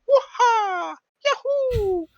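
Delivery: very high cartoon Mario yell; Mario whoop. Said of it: "he's like".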